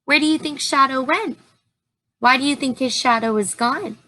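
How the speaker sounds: ordinary delivery, nothing marked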